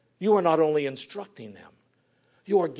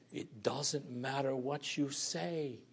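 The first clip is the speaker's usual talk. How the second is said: normal talking voice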